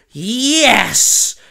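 high, loud triumphant cheer; boy cheering as he floats, loud and high. Acted. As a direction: cheer at finally flying. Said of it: "he cheered".

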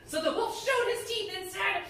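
put-on lively read-aloud to children; animated storyteller voice; storybook reading. A narrator's reading. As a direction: tell it like a bedtime story to kids.

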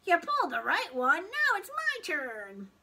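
high, loud, insistent whine; stretched-out pleading complaint; whining. A narrator's reading. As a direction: demand your turn like a whiny child. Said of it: "whined the pigtail".

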